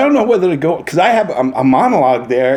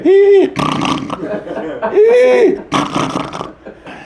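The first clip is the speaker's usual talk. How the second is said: high squeal, then rattling snore; whistling inhale with a rough snort; imitated sleepers' snoring. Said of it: "then they fall asleep. So you hear".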